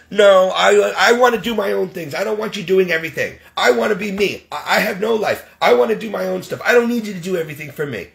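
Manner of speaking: high-pitched protest in her voice; hurried, put-on indignant whine; mock defiant patient. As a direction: imitate a stubborn, complaining refusal. Mimics Donna protesting his help.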